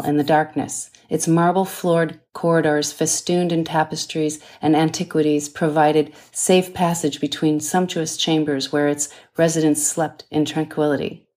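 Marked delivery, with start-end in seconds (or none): none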